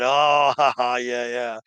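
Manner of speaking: a hint of pirates chuckling Oh, ha ha, yeah; mock crowd laughter, lightly voiced; crowd laughing along. Pirate crew laughs along at the captain, lightly voiced. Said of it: "sort of like from the crowd".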